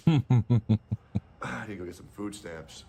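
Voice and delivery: slow drawn-out mock voice; exaggerated mocking drawl. Mocking stereotype after quote.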